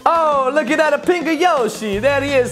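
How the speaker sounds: ordinary talk, nothing marked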